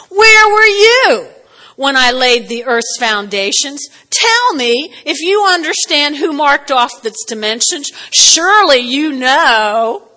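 God reading the riot act, slow and loud; booming God voice. Retold or imitated. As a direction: boom it out as God challenging Job. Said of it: "So he says".

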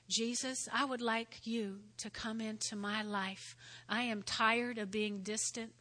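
soft, sincere prayer voice; sinner's prayer. Acted. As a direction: pray it gently for them.

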